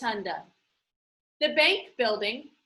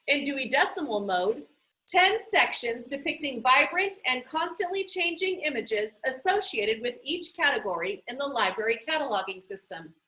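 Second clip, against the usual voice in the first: ordinary delivery, nothing marked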